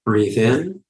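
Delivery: unhurried phrases with long silences between; guided meditation. Real, not acted. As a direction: lead listeners gently through each breath, in and out.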